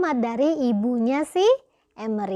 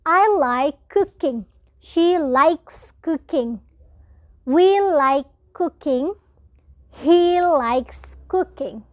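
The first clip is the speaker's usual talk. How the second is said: slow, clear model sentences for students; teacher modelling English slowly; slow example reading; 2 semitones higher, much slower than usual. Shown as a demonstration. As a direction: read each example slowly so the class can follow.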